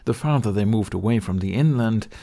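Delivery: plain reading voice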